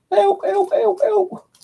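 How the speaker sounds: high falsetto mock cry for help; hammed-up damsel-in-distress squeal; mock screaming out. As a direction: squeal in a pretend panic, very high. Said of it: "I'll be screaming out".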